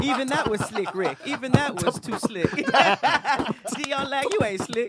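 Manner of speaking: high, loud, laughing through the words; can barely get it out for laughing; laughing hard. Real, not acted. Cracking up at a friend's slick rap line, laughing loudly.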